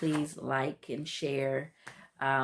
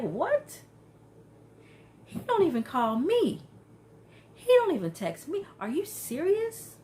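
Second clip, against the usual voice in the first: much higher, outraged shock; shocked disbelief; 11 semitones higher, 3 dB louder than usual. Retold or imitated. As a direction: squeal it in disbelief. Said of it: "Minnie was like".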